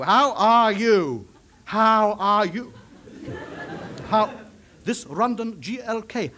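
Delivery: very slow, word by word; drawn-out, over-careful enunciation; slow for a foreigner. Acted. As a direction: stretch out each word so a foreigner can follow. Spelling it out for foreign listener.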